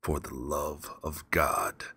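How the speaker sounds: slow, low mocking repeat; cold mocking echo. A narrator's reading. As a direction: throw his plea back coldly. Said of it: "I said".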